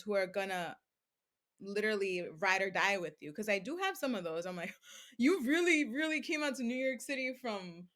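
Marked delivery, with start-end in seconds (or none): 5.0-7.9 delighted surprise. Retold or imitated. She gushes in surprise at followers from Amagansett. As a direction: gasp with joy that fans traveled to see her.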